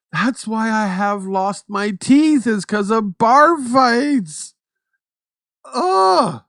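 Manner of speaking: high, slow, loud joking wail; mock toothless brawler. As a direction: ham up the bar-fight victim. Joking about lost teeth.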